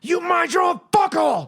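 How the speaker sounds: very high, slow screamed line; movie quote shout. Retold or imitated. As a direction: bellow it like the movie character. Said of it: "it was I Love You Man".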